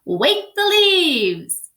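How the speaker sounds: loud, slow, high call of "Wake the leaves"; strong call. Acted. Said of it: "Wadney's voice was stronger".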